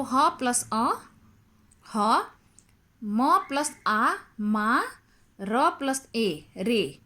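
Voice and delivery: drawn out "म plus आ, मा"; slow sounding out. Shown as a demonstration. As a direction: slowly blend each pair, "मा", "रे". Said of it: "वर्ण विच्छेद करते हैं". ("म प्लस आ, मा" धीरे और साफ़ बोलना)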